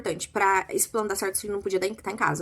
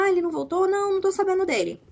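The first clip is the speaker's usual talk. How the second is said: high, louder fake innocent surprise; feigned surprise; 11 semitones higher, 4 dB louder than usual. Dolores to the maid on the phone, fake surprised innocence. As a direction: play dumb with a bright mock surprise.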